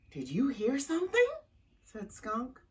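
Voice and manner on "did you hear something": quick, high, voiced question; alarmed question. A narrator's reading. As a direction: ask it fast with rising pitch, startled. Same question later repeated in whisper.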